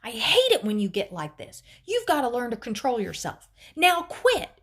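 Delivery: angry scolding, building to a loud, high command; screaming. A narrator's reading. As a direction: scold at full voice, then yell the order. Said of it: "Mom screamed over the thunder".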